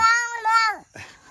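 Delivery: a loud, high burst of laughter; high delighted laugh. Real, not acted. Dad laughing loud and high at the chocolate egg.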